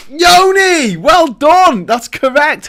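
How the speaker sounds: loud, high-pitched cheer for the quiz winner; excited congratulations. Real, not acted. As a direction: shout out the winner in delight. Congratulates viewer who answered quiz.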